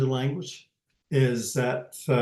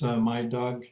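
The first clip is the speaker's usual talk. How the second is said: usual speaking voice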